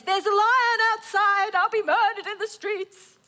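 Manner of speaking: high, loud sluggard's cry of dread; hammed-up panicky warning; mock alarm. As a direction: wail it high, overdoing the fear. Acts the sluggard's alarmed excuse.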